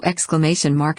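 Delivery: plain reading voice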